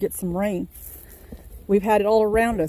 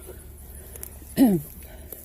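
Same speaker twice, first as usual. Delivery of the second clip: throat clearing. Real, not acted. Pausing in garden tour.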